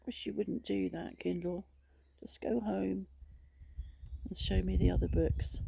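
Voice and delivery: soft grumble at a gadget; hushed, exasperated aside; muttered complaint. Real, not acted. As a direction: mutter under your breath to a misbehaving device. Muttering at her misbehaving Kindle.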